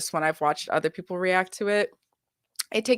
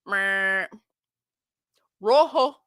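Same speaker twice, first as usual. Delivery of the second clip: sassy dismissal; 5 semitones higher, 4 dB louder, much slower than usual. Retold or imitated. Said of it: "she gonna be like".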